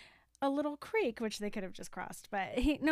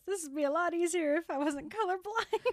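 higher-pitched mock grumble; retold complaint; 6 semitones higher, 3 dB louder than usual. Recapping a movie to a friend, mock complaint. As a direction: voice a film character griping. Retelling film, voicing Donkey's line.

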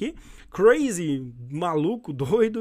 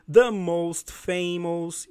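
slow model reading; 4 dB louder, much slower than usual. Shown as a demonstration. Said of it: "teacher models the phrase".

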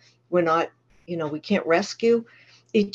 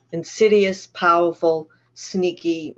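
ordinary talk

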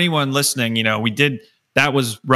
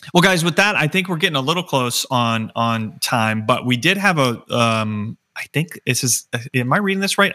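ordinary talk

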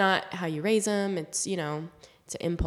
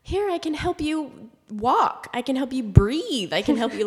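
put-on sweet toddler voice; 6 semitones higher, 3 dB louder than usual. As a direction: coo it like an eager toddler helping. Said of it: "she's just like".

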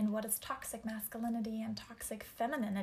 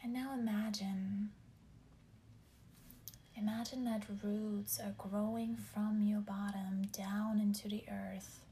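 slow, whispered meditation voice; guided meditation; slower than usual. Acted. Leads grounding meditation.

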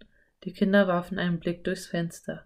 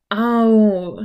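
loud short ow; groaning ouch as the boot pinches; boy groaning over a stiff boot; groan of pain; 3 semitones higher, 8 dB louder than usual. A narrator's reading. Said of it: "stöhnte er".

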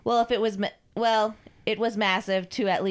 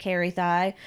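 plain reading voice

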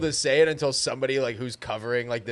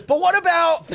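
mock whiny; 8 dB louder, slower than usual. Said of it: "John just goes".

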